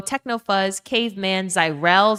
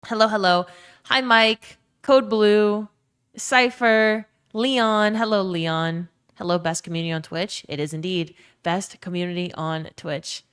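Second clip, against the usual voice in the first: ordinary talk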